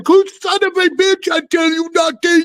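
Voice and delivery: high-pitched imitation of a freaked-out Swede; mock Swedish accent. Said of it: "he has a really strong accent".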